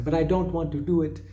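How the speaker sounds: put-on a light Indian or Arabic accent, as the singer does it; imitating Indian accent. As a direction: mimic the singer's Indian-sounding accent on the line. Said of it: "saying it with an Indian accent or an Arabic accent".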